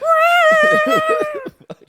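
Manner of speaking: very high, loud cat shriek; one long imitated feline scream; cat screech. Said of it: "the fucking other cat's like".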